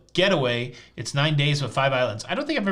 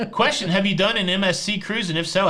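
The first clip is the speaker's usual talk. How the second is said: normal talking voice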